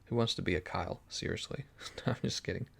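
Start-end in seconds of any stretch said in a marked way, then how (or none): 1.1-2.7 whispering. Real, not acted. Teasing viewer, whispered aside.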